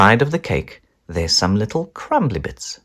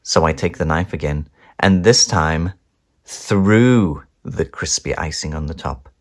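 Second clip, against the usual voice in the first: ordinary delivery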